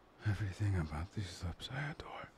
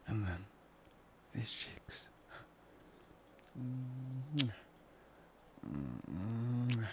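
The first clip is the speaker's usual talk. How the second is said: ordinary delivery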